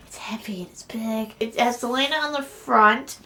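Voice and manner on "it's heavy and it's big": soft awed whisper. Real, not acted. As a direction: whisper it as you feel the weight. Whispering while holding book.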